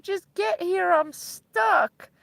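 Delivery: mock tearful friend; retold crying friend. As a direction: imitate a friend crying down the phone. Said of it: "she's crying on the phone".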